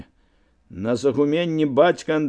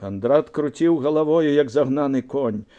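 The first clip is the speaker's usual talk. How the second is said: ordinary delivery, nothing marked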